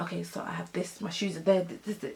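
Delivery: very soft, low mutter to oneself; muttered inventory. Retold or imitated. Wary friend checking that nothing is missing, muttering very quietly. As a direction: count items off under your breath. Acts out counting belongings warily.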